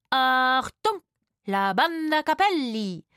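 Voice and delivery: loud, high, drawn-out showman's call; theatrical announcement. A narrator's reading. Rufus presenting the band to the actors, theatrical announcement. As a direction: announce the band with grand flourish. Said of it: "rief Rufus theatralisch".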